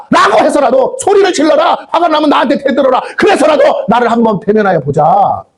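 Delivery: rising to a shouting climax; fiery preaching. Acted. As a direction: preach it at full force, slowing on key words. Preaching at full force.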